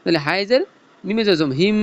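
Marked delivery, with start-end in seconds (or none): 1.6-1.8 "হিম" with the m hummed long; ghunnah. Shown as a demonstration. Teacher spelling it out, "হিম" with a long nasal m. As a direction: hold the end of "হিম" in the nose. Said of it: "এখানে গুণা আমরা কি জন্য করছি".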